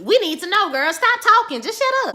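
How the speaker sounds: high, loud scolding at the screen; yelling at Tegan on TV. Real, not acted. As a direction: tell her to shut up.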